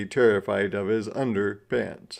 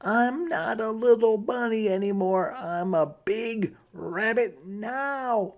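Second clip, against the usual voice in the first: put-on high, fast child's voice; quick high kid voice, proud; little rabbit voice; 9 semitones higher than usual. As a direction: insist you're grown up, like a little kid. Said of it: "said Jasper".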